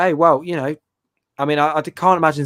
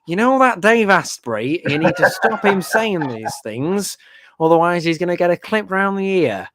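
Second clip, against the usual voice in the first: mock YouTube warning; 5 semitones higher than usual. Host voicing YouTube scolding him about a guest, mock stern. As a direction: put on a prim telling-off voice. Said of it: "email from YouTube going".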